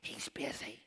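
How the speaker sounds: hushed whisper with shh; parents shushing. Retold or imitated. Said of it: "Parents are going".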